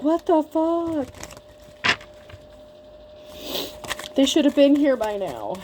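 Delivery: a baffled indignant squawk; mock disbelief. Ex imitated finding she never came back: high, slow mock disbelief. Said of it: "And they're like".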